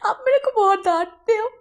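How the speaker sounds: high, loud sobbing protest; crying complaint. Acted. Servant complaining to his scolding boss, loud and sobbing. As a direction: wail it like a scolded child.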